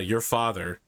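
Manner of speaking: ordinary delivery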